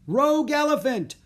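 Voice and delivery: high, loud street cry; shouted alarm. A narrator's reading. Banana seller warns the bazaar, shouting. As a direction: shout the alarm across a market. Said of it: "a banana seller shouted".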